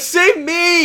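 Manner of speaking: high, loud mock-desperate scream; retold plea. Slipping boy to friend on the rock, desperate scream. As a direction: cry out for rescue, hammed up. Imitates own plea in current.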